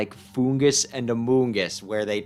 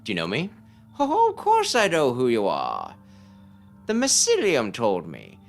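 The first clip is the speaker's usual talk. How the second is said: put-on eccentric old sage; 11 semitones higher, 3 dB louder than usual. Player voicing the strange old mushroom sage for the boy. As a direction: coo it like a batty hermit who hears mushrooms.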